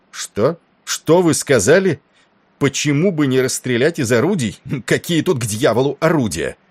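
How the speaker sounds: high-pitched, incredulous anger into a phone; enraged. A narrator's reading. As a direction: explode with indignation at the suggestion. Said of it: "не видел Тернера таким разгневанным". (разгневанно, с возмущённым недоумением)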